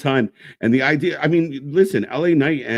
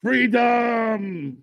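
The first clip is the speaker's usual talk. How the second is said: high, drawn-out mock war cry; hammed-up rallying cry; Braveheart battle cry; 10 semitones higher than usual. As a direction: stretch it out like a film hero rallying troops. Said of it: "What is Braveheart?".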